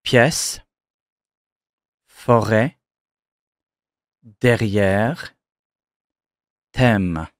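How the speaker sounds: Pièce, forêt, derrière, thème with an open è; è ouvert; French open e. Shown as a demonstration. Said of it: "That too is pronounced E".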